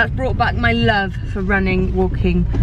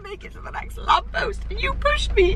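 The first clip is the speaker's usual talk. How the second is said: whiny upset self; 14 semitones higher than usual. Retold or imitated. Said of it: "and then I'm like".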